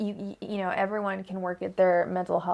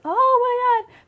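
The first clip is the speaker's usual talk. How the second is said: much higher, louder mock squeal of giddy delight; 10 dB louder, slower than usual. A reader teasing the viewer's giddy excitement, mock squeal. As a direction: squeal it like a smitten fan. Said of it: "make you feel giddy".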